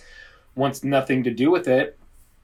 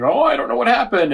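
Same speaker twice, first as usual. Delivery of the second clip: mock innocent; 11 semitones higher, 4 dB louder than usual. Rios feigning innocence at bar.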